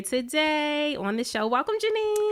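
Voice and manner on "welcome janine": high, loud gushing welcome; excited welcome. Real, not acted. Host welcoming guest Janine on the show, excited and high. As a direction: greet her like a best friend.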